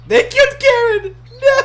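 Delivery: mock-tragic high, loud cry; loud, high, over-dramatic dismay; horrified yell. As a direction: wail it out in hammed-up horror. Game companion just killed.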